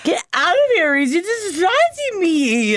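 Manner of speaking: high playful get-out voice; high mock shoo. Mock Feyre shooing Rhys away.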